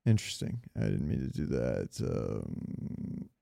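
ordinary delivery

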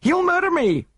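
very high, loud panicked cry; terrified shriek. Acted. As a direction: scream it in pure terror. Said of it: "she shrieked".

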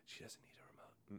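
whisper, very soft; whispered aside. Real, not acted. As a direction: drop to a whisper. Aside to listener.

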